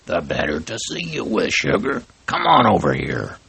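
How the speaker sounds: lightly voiced sly wolf, mock hoarse; a hint of a wolf playing a sick old lady; wolf faking granny. Wolf posing as grandma.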